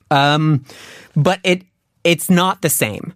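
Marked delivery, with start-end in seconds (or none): none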